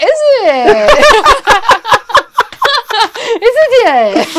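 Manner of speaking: high, loud, laughing mock of Jack; imitating Jack. Podcast host mimics Jack watching the episode, laughing high. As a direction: mimic Jack's reaction through laughter. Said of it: "Jack's gonna see this and be like".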